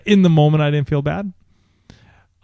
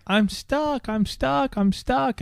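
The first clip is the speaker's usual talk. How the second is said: put-on high, soft whiny child voice; whimpering I'm stuck like a little girl; imitating his crying daughter; 4 dB quieter, slower than usual. Father mimicking his upset daughter, high and whiny. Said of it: "she's just like".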